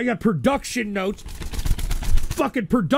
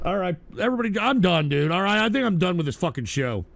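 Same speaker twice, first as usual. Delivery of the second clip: usual speaking voice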